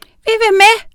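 high, squeaky clamour; loud, very high little voice; shouting little witches. A narrator's reading. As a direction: call out like tiny witches begging along. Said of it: "råbte nioghalvfems små hekse".

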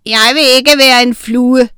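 shouted objection; screamed protest. A narrator's reading. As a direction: yell it out in protest. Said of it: "skreg Egon".

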